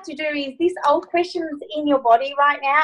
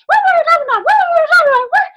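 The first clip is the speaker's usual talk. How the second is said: squeaky and much higher, rattled off fast; 6 dB louder, much faster than usual. Shown as a demonstration. Coach demonstrating a silly high-pitched take of the question. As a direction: pitch it way up and race through it. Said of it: "really fast, fastest, forwardest pitched voice".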